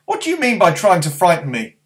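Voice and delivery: high, loud and cross; boy crossly telling off an owl. Acted. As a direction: scold the owl like a small boy. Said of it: "said Barney crossly".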